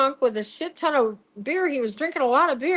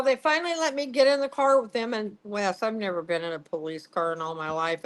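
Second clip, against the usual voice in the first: ordinary delivery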